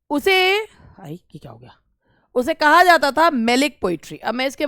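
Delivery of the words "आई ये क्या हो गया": very quiet, low self-talk; muttered aside. Real, not acted. Teacher to herself when something goes wrong mid-lesson, muttered aside. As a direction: drop right down, as if talking to yourself.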